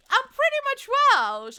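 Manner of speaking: excited proud claim. Real, not acted. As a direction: proudly squeal it.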